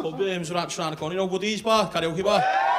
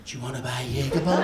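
camp sales pitch; 6 semitones lower, 3 dB louder than usual. Retold or imitated. Seller persists.

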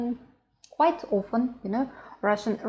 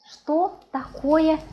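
slow, higher "Что такое?"; slow demonstration; 7 semitones higher, 3 dB louder, slower than usual. Shown as a demonstration. Teacher showing how to ask about a dish, slowly. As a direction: stretch out "Что такое?" word by word.

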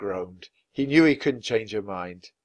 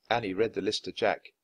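ordinary delivery, nothing marked

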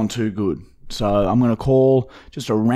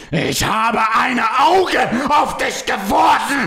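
mock German shout; 9 semitones higher than usual. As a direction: yell it like a cartoon German. Shouting German phrases for laughs.

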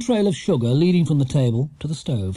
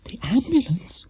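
high, shocked question; alarmed cry; 9 semitones higher than usual. A narrator's reading. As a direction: echo it back in alarm. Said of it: "cried Missus Brown, going quite pale".

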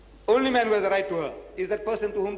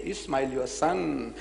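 slow emphatic quoting; 5 semitones lower, 3 dB quieter, much slower than usual. Retold or imitated. Repeats God's words for emphasis.

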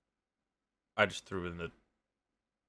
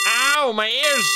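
pained yelp; 9 semitones higher, 6 dB louder, slower than usual. Real, not acted. Loud phone tone.

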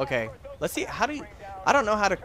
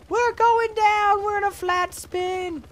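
much higher, slow drawn-out wail; falling panic; 3 dB louder, slower than usual. Real, not acted. Pilot in flat spin wailing we're going down. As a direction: wail as the chopper spins. Helicopter spinning down.

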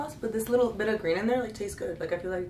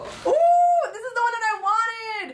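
high squeal of delight; squeal, thrilled with it; excited squeal; 12 semitones higher, 4 dB louder, slower than usual. Real, not acted. Delighted at her pick.

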